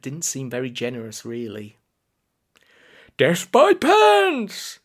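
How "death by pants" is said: high, drawn-out, loud echo; joining the war cry. A narrator's reading. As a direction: chime in eagerly with the slogan. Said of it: "Grandfather added".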